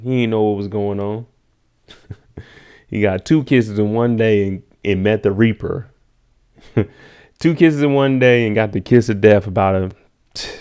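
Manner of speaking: normal talking voice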